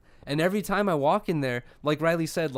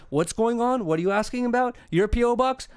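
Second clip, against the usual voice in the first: high, nosy, suspicious clerk voice; mock demon lady; 6 semitones higher than usual. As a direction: barge in as the dreaded clerk, higher. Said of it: "demon lady comes through".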